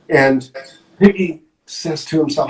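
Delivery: ordinary delivery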